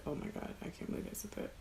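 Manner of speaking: usual speaking voice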